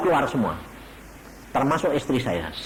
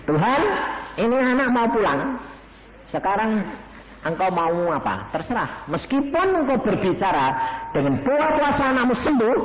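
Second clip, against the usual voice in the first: higher, louder pleading prayer; father praying over his dying son; 10 semitones higher, 3 dB louder than usual. Acted. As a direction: cry out to God for the boy. Father prays aloud.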